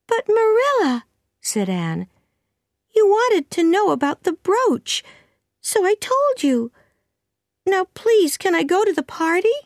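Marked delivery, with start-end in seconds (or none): 0.1-1.0 put-on very high girlish protest; high-pitched whine of protest; protesting young Anne. Narrator voices pleading Anne. 2.9-9.6 put-on very high, insistent girl's voice; high-pitched childish pleading; pleading young Anne.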